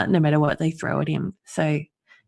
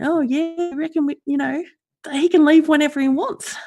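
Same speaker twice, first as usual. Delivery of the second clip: mocking; 11 semitones higher than usual. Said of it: "standing around going".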